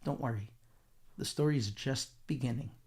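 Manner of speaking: low-key, measured promise with pauses; calm reassurance. Retold or imitated. Hashem answers Moshe's complaint, calm reassurance.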